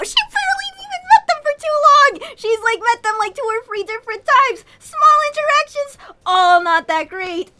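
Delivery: outraged rant. Real, not acted. Player defending Mary against Kroven, high loud rant. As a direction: vent at the screen, indignant.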